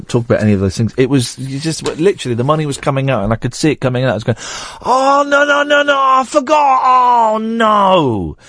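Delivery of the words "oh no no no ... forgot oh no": high wailing, drawn-out "no"; mock despair. Host reliving money coming out of the machine, mock despair. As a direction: wail in hammed-up horror as it dawns. Retells panic at cash machine.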